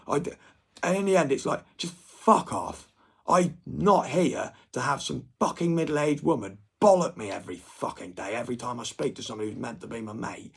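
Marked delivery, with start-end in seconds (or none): 1.8-9.2 fed up with a scolding mother, loud and angry; angry rant. Real, not acted. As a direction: spit it out, fed up and furious. Ranting at the scolding mum.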